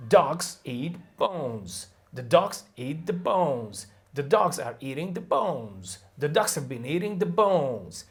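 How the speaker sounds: each sentence held to the same rhythm, extra words crammed in; same-beat rhythm demo. Shown as a demonstration. Teacher proving stress timing, same length each time. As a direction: keep the beat on "dogs", "eat", "bones". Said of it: "Let me prove it".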